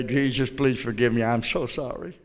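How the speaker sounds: a touch of retold pleading, hushed; quiet pleading prayer. A young convert on his knees, quietly begging Jesus for forgiveness.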